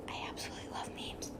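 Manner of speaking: fast unvoiced whisper; whispered confession of loving memes; whispered aside. Real, not acted. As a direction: breathe it like a secret.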